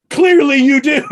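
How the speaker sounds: high, laughing mock coworker; laughing retort. Coworker catching him out, high and laughing. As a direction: snap it back while cracking up. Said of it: "she went".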